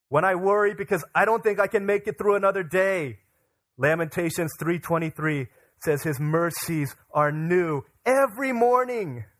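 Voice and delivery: loud, high, rallying sermon delivery; impassioned preaching. Real, not acted. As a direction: proclaim it to the congregation, building in force. Preaches at full force.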